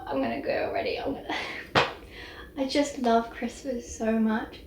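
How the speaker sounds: soft, choked-up gushing; welling up. Real, not acted. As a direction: overcome with sentiment, voice dropping away. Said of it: "I just start welling up".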